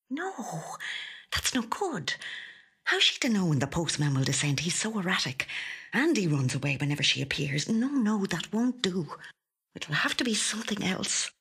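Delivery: high, hushed worrying; fretful whisper. A narrator's reading. Louise fretting that the postman will not do, high fretful whisper. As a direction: whisper it as anxious inner thoughts.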